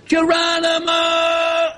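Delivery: long high shout, held on the last syllable; high, stretched-out jump cry; drawn-out yell. Acted. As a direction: yell it out like a skydiver's war cry. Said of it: "yell, 'Geronimo!'".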